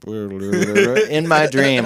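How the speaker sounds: laughing through the words, slow. Real, not acted. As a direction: chuckle it out slowly.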